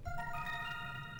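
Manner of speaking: sharp breathy gasp of surprise. Real, not acted. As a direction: gasp at the sight. Spotting a bug.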